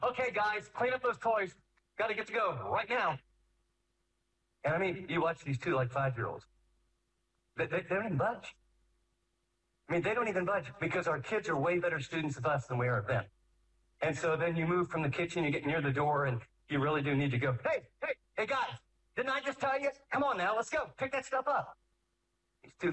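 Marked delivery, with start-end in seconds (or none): 0.0-3.2 put-on higher, pleading parent calling to the kids; sing-song nagging at the kids to pack up; a parent half-heartedly telling kids to tidy up; frazzled parent. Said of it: "this is self-confession". 17.6-21.8 much higher, whiny exasperated nagging; a frustrated parent begging the kids to pick up. Retold or imitated.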